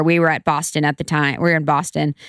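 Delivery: ordinary talk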